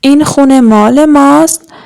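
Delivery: usual speaking voice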